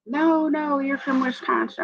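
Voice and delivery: high-pitched mock old-lady insistence; argumentative older lady. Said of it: "she was so argumentative".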